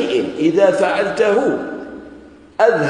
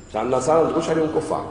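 ordinary talk, nothing marked

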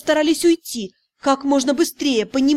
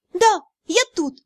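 raised voice; 7 semitones higher than usual. A narrator's reading. Heroine calling to the cat behind the door, raised voice. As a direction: call back higher through the door. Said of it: "повысив голос".

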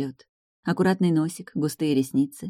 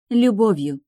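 higher, firmly declared answer; brave declaration; 5 semitones higher, 4 dB louder, slower than usual. A narrator's reading. Girl telling Velizar how she will save him, brave declaration. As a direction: declare it with some courage. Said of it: "храбро ответила девушка".

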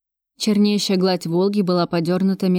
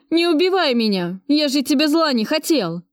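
higher, plaintive begging; 7 semitones higher than usual. Acted. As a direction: beg pitifully for your life. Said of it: "жалобно попросил бандит".

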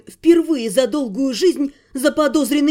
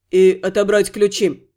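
lower, lightly amused warrior voice; faint smirk; 6 semitones lower than usual. Acted. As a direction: finish her line with a hidden grin. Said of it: "с едва заметной усмешкой".

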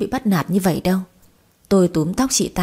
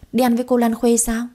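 put-on higher, pouty little-girl question; pouting child; 4 semitones higher than usual. A narrator's reading. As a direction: ask with a reluctant pout. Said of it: "Tuệ Mẫn bĩu môi nói".

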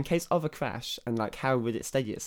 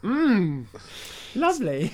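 satisfied mmm; 10 semitones higher, 4 dB louder, slower than usual. Pretend. Savouring milk in crash.

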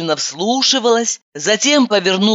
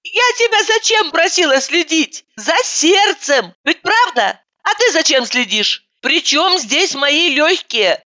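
exasperated rant; 8 semitones higher than usual. A narrator's reading. Continues yelling at roommate.

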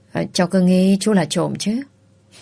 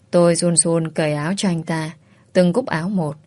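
ordinary delivery

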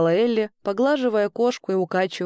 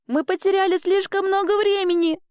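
high-pitched panic; frightened girl; 9 semitones higher than usual. A narrator's reading. Girl fearing they lost time, frightened. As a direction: cry out high, alarmed. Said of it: "испуганно вскрикнула Элли".

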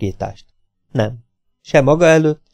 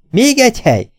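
pitched up high, incredulous outburst; exasperated outcry; 9 semitones higher than usual. A narrator's reading. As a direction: throw the words up high in disbelief.

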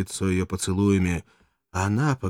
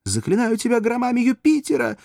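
feigned terror; 6 dB louder than usual. Acted. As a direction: cry out in faked horror. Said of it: "закричала куртизанка, притворяясь поражённой ужасом".

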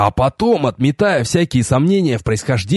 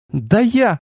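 indignant goddess; 7 semitones higher than usual. A narrator's reading. Narrator voices goddess's interrupted threat.

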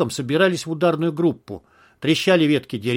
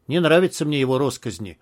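usual speaking voice

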